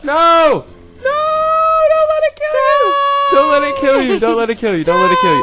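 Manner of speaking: frantic high screaming; panicked yelling. Real, not acted. Panicking over partner's creeper.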